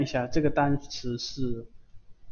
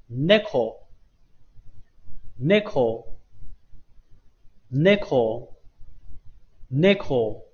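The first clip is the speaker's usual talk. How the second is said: "neko" with NE stressed, slowly repeated; stress on first syllable; much slower than usual. Shown as a demonstration. Teacher models the word for cat, slow "neko" stressed on NE. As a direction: hit the NE of "neko", leave gaps between repeats. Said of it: "重读第一个".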